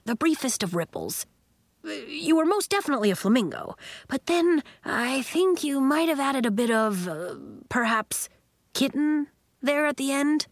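lightly voiced coach, rising as he goes; tactful Coach, hesitating. A narrator's reading. Coach tells Nori about the kitten slip, tactfully hesitant. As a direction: choose the words carefully, breaking off to hedge.

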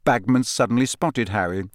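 plain reading voice

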